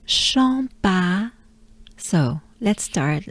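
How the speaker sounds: ordinary delivery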